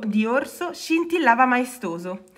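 ordinary delivery

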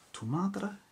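hammed-up Spanish accent; put-on Spanish accent. Shown as a demonstration. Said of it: "Why am I doing this damn accent".

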